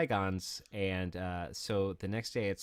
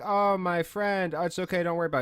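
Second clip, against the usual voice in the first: mock innocent dismissal; 7 semitones higher than usual. Brushing off the dad.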